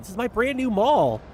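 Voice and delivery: real outcry at the tornado; dismayed shout. Real, not acted. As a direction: wail at the wreckage. Tornado hits her new mall.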